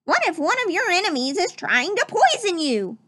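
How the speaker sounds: a hint of fussy duck butler; Donald Duck voice. Butler Donald warns Mickey of poison, lightly quacked. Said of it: "said Donald".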